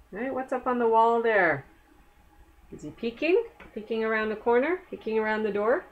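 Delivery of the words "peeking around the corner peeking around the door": ordinary delivery, nothing marked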